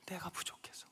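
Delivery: hushed confession; whispered. Real, not acted. Preacher voicing Nehemiah's guilt, whispered. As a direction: whisper 내가 부족해서.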